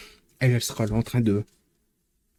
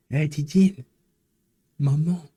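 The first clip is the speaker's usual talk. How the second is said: playful calling out; 6 semitones higher, 4 dB louder than usual. Pretend.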